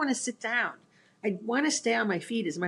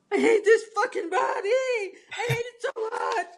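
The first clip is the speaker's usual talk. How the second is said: high, loud, drawn-out wail of frustration; hammed-up frustrated yell, pitched way up; retold yelling; 4 dB louder than usual. Retelling a fall in the restaurant, yelling. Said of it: "I'm, like, yelling this".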